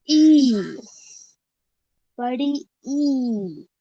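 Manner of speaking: ई stretched long, falling at the end; long drawn-out vowel. Shown as a demonstration. Teacher models बड़ी ई for repeating, long drawn-out ई. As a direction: hold the vowel ई well past normal length. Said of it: "लंबा खींचना है".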